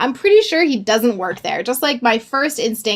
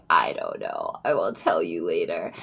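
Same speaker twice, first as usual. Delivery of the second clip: much lower, softer muttered reply; 10 dB quieter than usual. Retold or imitated. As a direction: keep it low so he won't hear. Muttering to partner on the phone.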